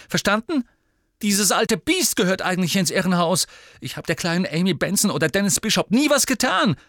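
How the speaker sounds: high, loud, fast accusing; angry outburst. A narrator's reading. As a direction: shout it back in fury. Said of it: "mit zorniger Miene".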